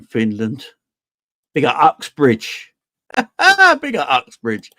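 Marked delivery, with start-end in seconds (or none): 3.1-3.8 sudden high, loud laugh; burst of laughter. Real, not acted. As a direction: crack up at the name. Laughs at viewer's location.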